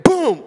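big mock crash sound; loud boom. The speaker mimics his son falling on the ice, loud boom. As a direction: slam it like a fall.

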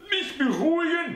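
incredulous high mother's voice; indignant old mother. A narrator's reading. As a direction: throw his words back at him.

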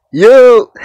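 sudden loud, high yell. Shown as a demonstration. Testing the pop filter, he yells yo at full force. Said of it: "If I project my voice louder".

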